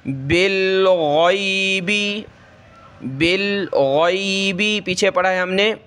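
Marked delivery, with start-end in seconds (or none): 0.1-4.8 بالغیبی with a heavy غ from the throat; slow, guttural غ in بالغیبی; teacher repeats the heavy ghain; throaty ghain. Shown as a demonstration. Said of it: "گلے سے نکالیں".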